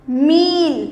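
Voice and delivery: मील with a long, firm ee; मील, लंबी ई ज़ोर से; long ee, stressed. Shown as a demonstration. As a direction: stretch the ee in मील. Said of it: "उसको थोड़ा सा ज़ोर की बोलेंगे".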